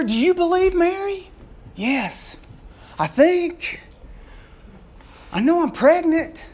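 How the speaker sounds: high, eager questions back and forth; excited mothers-to-be. Acted. Mary and Elizabeth marvelling at each other, high and excited. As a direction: play both women amazed and thrilled. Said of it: "it wasn't a stoic moment".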